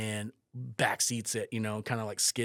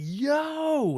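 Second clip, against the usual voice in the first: long, high, loud holler; imitated calling out; 8 dB louder than usual. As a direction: holler down from a rooftop. Friend calling from rooftop retold.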